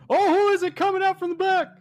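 high, excited mock commentator; wrestling announcer. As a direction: call a surprise ring entrance. Mock wrestling commentary on Rob's entrance.

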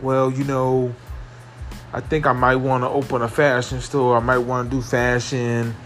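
usual speaking voice

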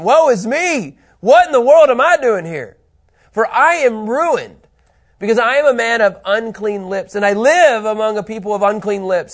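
overwhelmed prophet's loud, high lament; impassioned, high-pitched outcry; Isaiah. Acted. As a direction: cry out in alarm at your own unworthiness. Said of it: "this is Isaiah".

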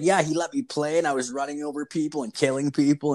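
high, eager child imitation; little kid tattling. Kid tells mom what he played, tattling. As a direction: play the kid happily spilling everything. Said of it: "he's like".

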